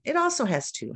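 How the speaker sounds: normal talking voice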